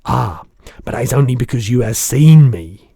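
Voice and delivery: loud booming giant; BFG crying out. Acted. Giant answering the girl, a loud cry. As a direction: call it out, big and eager. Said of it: "cried the B F G".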